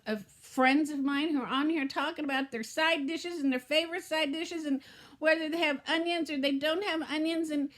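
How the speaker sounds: ordinary talk, nothing marked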